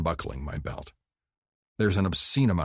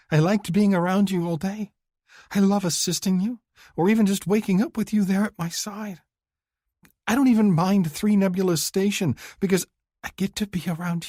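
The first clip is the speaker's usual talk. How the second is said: high, earnest woman's voice, louder with feeling; pleading young woman; 13 semitones higher, 4 dB louder than usual. Acted. Woman confessing to her lover she wants him to stay, high and pleading. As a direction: pour out how much you want him near.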